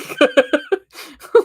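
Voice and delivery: high, loud laugh; real laugh. Real, not acted. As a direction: laugh along with chat. Laughing with chat.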